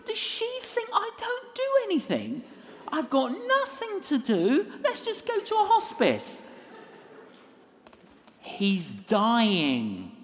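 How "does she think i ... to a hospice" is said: very high, incredulous mock complaint; sarcastic protest. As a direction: rant sarcastically in a squeaky-high register. Sarcastic inner protest, audience laughing.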